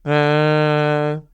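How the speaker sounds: level, steady "Uh."; flat first tone. Shown as a demonstration. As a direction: hold "Uh." on one flat pitch. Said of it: "we say".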